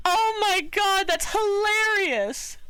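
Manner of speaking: high, drawn-out amused cry; tickled squeal. Real, not acted. Streamer reacting to a funny translation, high tickled squeal. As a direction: stretch it out, high and delighted.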